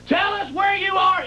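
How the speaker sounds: high-pitched mock panicked call; mock frantic calling. Imitating mother calling to the boy in the wall, mock panic. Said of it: "and she's going".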